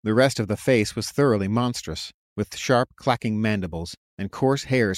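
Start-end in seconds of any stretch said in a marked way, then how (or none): none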